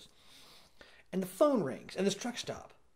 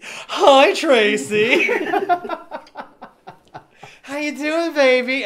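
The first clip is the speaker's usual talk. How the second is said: louder, higher eager caller; mock caller; 8 semitones higher, 10 dB louder, much slower than usual. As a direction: ham up a creepy caller. Friend voices a caller.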